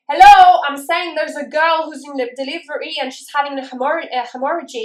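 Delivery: loud, high call for attention; insistent call. Retold or imitated. The nurse calling out to chatting colleagues, loud and insistent. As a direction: cut through the chatter, firm.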